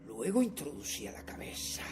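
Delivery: low, very soft storytelling; near-whispered, secretive confession; hushed stealth. Acted. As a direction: tell it as if creeping through a sleeper's door. Recounts stealthy creeping into room.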